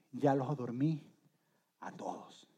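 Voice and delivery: trailing into a whisper; slow, hushed words fading to a whisper; hushed relief. Acted. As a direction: say it softly so nobody wakes. Settling after everyone is asleep.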